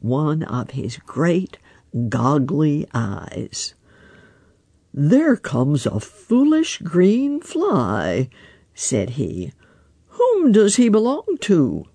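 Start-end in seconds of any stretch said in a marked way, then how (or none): 4.9-11.8 lightly voiced wise old frog, unhurried; a hint of a croaky old frog musing. Grandfather Frog teases.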